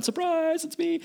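very high, soft mock squeal; light, high-pitched playful announcement; playful surprise greeting. Preacher pretending to turn up at the centurion's house, playfully high.

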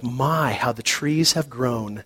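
soft, slow fond remark; tender. Acted. Old man looks at the old photo, softly fond. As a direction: murmur it fondly.

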